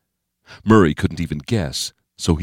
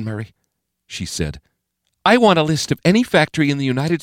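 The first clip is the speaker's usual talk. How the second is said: resolute President; 10 semitones higher than usual. A narrator's reading. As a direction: refuse to give up. President gives resolute orders.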